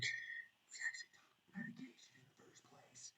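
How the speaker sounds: whispered aside. Real, not acted. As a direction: drop to a secret whisper. Muttered aside about medication.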